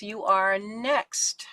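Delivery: ordinary talk, nothing marked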